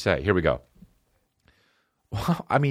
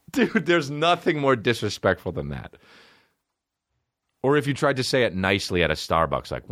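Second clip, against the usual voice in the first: usual speaking voice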